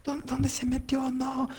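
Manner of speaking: high, slow mock panic; mother looking for her hiding son, in high mock anguish; imitating anguished mother. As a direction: play the frantic mom, half in jest. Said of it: "sale mi mamá así angustiadísima".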